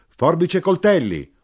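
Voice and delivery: grido alto e forte da arrotino; urlo. A narrator's reading. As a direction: urla come un richiamo di strada. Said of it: "urlò allora l'arrotino". (urlo da venditore ambulante)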